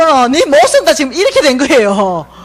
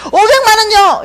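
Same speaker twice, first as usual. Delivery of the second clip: much higher, loud eager answer; eager blurt; 10 semitones higher, 4 dB louder than usual. Retold or imitated. A daughter blurting her wish to God, high and eager. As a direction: blurt it out, thrilled.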